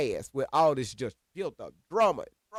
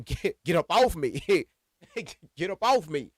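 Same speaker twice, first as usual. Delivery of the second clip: laughing retold command; 4 semitones higher, 3 dB louder than usual. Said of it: "I'm like".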